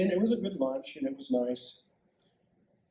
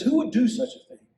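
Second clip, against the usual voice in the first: high, loud, shocked demand; king can't believe it; outraged question; 8 semitones higher, 4 dB louder than usual. Acted.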